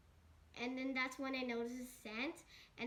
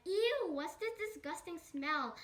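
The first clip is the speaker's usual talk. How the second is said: starfish's mock disgust; 5 semitones higher, 3 dB louder than usual. Starfish reacts to smelly socks.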